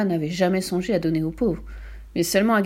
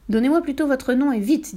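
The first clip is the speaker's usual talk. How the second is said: higher-pitched, urgent order; brisk demand; 6 semitones higher, 3 dB louder than usual. A narrator's reading. Librarian presses the panicked brigand for his name, brisk demand. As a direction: demand it with no nonsense.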